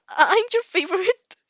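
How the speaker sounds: high squeaky flustered voice. Acted. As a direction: squeak it out, embarrassed and loud. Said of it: "he squeaked".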